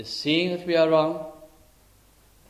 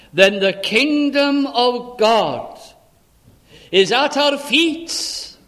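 full-force preaching; 5 semitones higher, 5 dB louder than usual. Real, not acted. Preacher proclaiming the kingdom of God is at hand, full-force preaching. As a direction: proclaim it to the congregation with long, weighty stresses.

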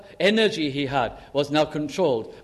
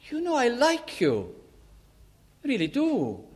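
pleading protest; 9 semitones higher than usual. Retold or imitated. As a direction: imitate an insistent, high-voiced plea. Peter protests again in story.

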